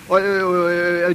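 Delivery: slow nervous uh uh uh; tongue-tied young musician; flustered stammer. Acted. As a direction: stall for words, caught off guard. Flustered before [name].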